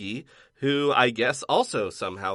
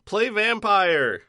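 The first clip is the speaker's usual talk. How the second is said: excited; 10 semitones higher, 3 dB louder, slower than usual. Real, not acted. Raving about the game.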